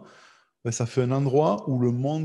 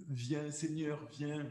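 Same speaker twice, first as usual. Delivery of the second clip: hushed, slightly raised prayer voice; soft plea; 3 semitones higher, 12 dB quieter, slower than usual. Acted. Believer calls on the Lord to come, soft plea. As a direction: call to the Lord very softly.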